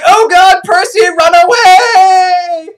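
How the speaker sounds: high, drawn-out shouted warning; mock scream. Captured wife warning her husband, hammed-up scream. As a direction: scream it out, stretching the last word. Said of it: "she just screamed".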